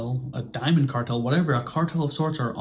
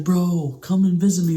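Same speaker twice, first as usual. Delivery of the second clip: higher and louder, mock eager; eager plea; 6 semitones higher, 5 dB louder than usual. Doug begging his old friend to visit, eager call. Doug quoted pleading with Griff.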